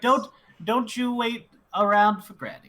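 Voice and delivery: put-on high, loud old woman calling out; a grandma calling after the others who left her behind; old granny calling.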